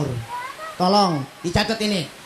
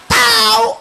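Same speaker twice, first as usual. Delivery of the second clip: "Tahu" high and loud, Betawi style; Betawi intonation; 11 semitones higher, 9 dB louder than usual. Acted. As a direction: throw out "Tahu" as a loud Betawi exclamation meaning no idea. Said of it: "tuh intonasinya beda". ("Tahu" dengan intonasi Betawi, tinggi dan kencang)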